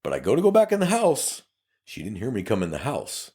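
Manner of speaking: ordinary talk, nothing marked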